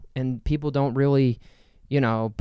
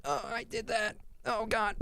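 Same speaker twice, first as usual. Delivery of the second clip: pained; 9 semitones higher, 12 dB quieter than usual. Retold or imitated. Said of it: "I'm like".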